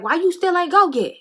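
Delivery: bossy friend voice, hammed up; imitating her way of talking. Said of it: "That's how she talk".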